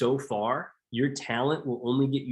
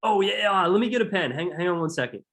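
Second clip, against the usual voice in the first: mock customer; 6 semitones higher than usual. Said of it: "You know what they always say?".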